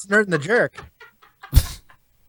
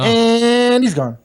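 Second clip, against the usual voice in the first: announcer sign-off; 5 semitones higher, 4 dB louder, much slower than usual. Pretend. Sign-off after caller hangs up.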